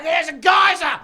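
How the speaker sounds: high, loud apocalyptic shouting; hyperbolic panic. Acted. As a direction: shout like the world is ending. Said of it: "We couldn't come up with enough hyperbole".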